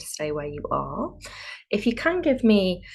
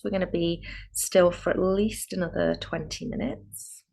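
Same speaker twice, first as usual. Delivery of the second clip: calm yoga guide with spacious pauses; guided meditation. Real, not acted. Yoga teacher preparing students for a still practice, calm guided meditation. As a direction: lead them inward, unhurried, phrase by phrase.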